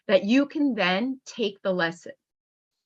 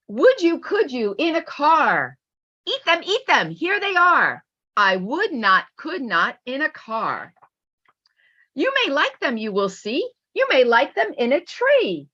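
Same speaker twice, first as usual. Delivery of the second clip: lively storybook reading, bouncing between the two characters; read-aloud voice; 3 semitones higher than usual. Acted. Teacher reading a Seuss book aloud, lively storybook voice. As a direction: read it out like a picture book to a class.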